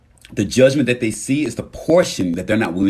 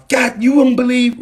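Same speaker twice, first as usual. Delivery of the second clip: very high mock outburst; exasperated venting; 4 dB louder than usual. Walking into the house upset, exasperated venting. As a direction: burst in complaining, pitch shot up.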